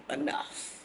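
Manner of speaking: breathy whispered word. Real, not acted. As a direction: drop to a whisper. Aside about one drink.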